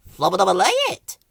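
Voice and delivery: fast gibberish question; flowerpot gibberish. Acted. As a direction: babble the question quickly. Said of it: "asked Ben".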